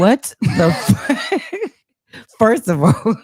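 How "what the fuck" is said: slow, laughing disbelief; cracking up mid-phrase. Real, not acted. Reacting to absurd profile.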